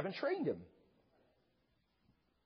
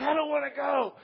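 higher-pitched mock child's whine; whining kid; 5 semitones higher than usual. Kid refuses to leave daycare with parents, mock whining. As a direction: play a balking kid. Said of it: "and their kids are like".

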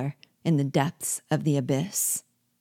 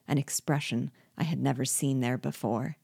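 ordinary delivery, nothing marked

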